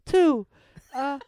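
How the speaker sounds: put-on high kid voice with a Delco accent; young boy doing a survey, Delco accent. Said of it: "my Delco accent".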